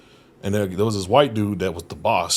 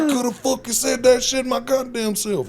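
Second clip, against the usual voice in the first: much higher mock exasperated rant; foreman's exasperation. Foreman scolding the fake translator, high mock exasperated rant. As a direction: rant like the annoyed boss. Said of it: "He's like".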